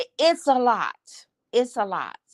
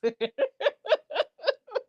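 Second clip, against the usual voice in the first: short rising high laugh; high giggle; 4 dB quieter than usual. Real, not acted. Laughing after reassuring viewers, rising giggle. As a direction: giggle upward.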